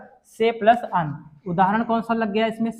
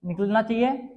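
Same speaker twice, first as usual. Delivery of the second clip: ordinary talk, nothing marked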